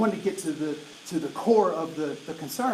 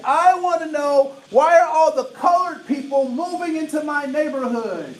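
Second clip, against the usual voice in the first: loud, higher imitation of the woman's pointed question; acting out the woman's question; 8 semitones higher, 8 dB louder than usual. Woman in the training audience confronting Andre, loudly and higher. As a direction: voice the woman rising to challenge the speaker. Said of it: "And she said".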